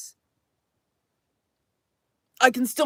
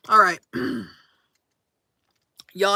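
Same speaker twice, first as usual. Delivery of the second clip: ordinary delivery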